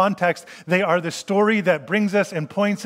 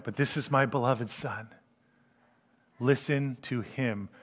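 solemn God; 5 semitones lower, 8 dB quieter than usual. Retold or imitated. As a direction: let it sink, quiet and weighty. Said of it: "And God now going".

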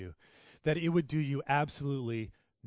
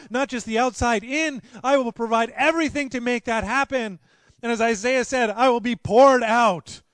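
louder and higher, ringing proclamation; fervent preacher building to a peak; preaching at full force; 8 semitones higher, 11 dB louder than usual. Acted. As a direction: thunder it out from the pulpit. Climax of prophecy, full force.

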